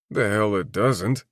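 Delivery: ordinary delivery, nothing marked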